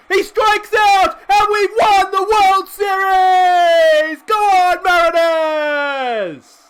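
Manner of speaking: the final out, and you've just won it all; ecstatic shout. Real, not acted. Last out wins the World Series.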